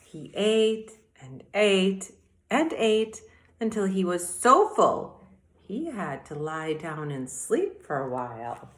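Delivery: ordinary delivery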